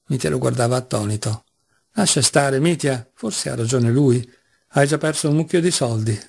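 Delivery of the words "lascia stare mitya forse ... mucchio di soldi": ordinary delivery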